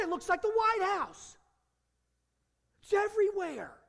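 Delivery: high, loud preacher's outrage; indignant preacher railing against a bribing king; fiery preaching. Acted. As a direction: thunder it from the pulpit in disbelief. Preaching at full force.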